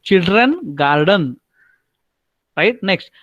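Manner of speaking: normal talking voice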